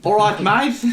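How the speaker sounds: a hammed-up, muddled Aussie accent; attempted Australian. Guest answering host's request for an Australian, high Australian accent. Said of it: "That sounds like one part Australian".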